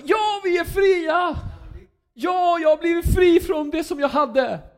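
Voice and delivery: high, loud cry of freedom; jubilant shout. Retold or imitated. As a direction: shout it out like freed slaves celebrating. People freed from Egypt rejoicing.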